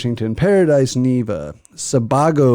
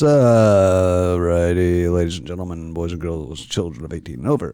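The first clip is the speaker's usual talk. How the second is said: mock announcer; 9 semitones lower than usual. Host opening the shout-outs to listeners, low announcer voice. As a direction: drop low and announce it to the crowd.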